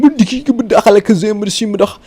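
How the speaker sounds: high-pitched gibberish chant; rapid made-up spell gibberish; mock magic incantation. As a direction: spout nonsense spell words, high and loud. Imitates the pranksters' magic words.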